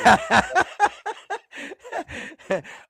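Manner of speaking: high, long burst of laughter; helpless high-pitched laugh. Real, not acted. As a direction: crack up mid-rant. Breaks up laughing mid-rant.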